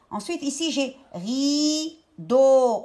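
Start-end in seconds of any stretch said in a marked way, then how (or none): 1.1-2.8 "rideau" very slow, long "ri", long "deau"; syllable-by-syllable "rideau"; split into syllables. Shown as a demonstration. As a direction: stretch both halves of "rideau". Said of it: "On me demande la deuxième syllabe".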